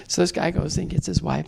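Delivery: usual speaking voice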